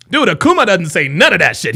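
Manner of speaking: usual speaking voice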